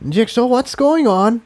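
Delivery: high, alarmed mare voice; a sharp cry of worry. Acted. Incendia alarmed, asking Jigsaw what is happening. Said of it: "more than a little alarm in her voice".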